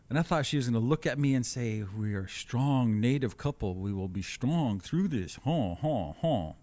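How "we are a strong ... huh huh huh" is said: slow, solemn movie chief voice; lightly hammed-up stoic movie chief, slowly; stoic native chief. Husband imagines wife as solemn movie warrior, mild mock solemn. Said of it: "You saw Dancing with the Wolves".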